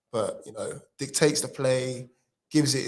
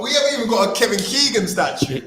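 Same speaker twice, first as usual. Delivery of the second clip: excited; 9 semitones higher, 9 dB louder than usual. Real, not acted. Mock outrage at statue claim.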